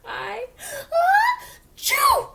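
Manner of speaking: high, loud ah building to a chew; big sneeze. Acted. Gerald sneezing hard while explaining to Piggy, loud. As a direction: wind up and let the sneeze burst out.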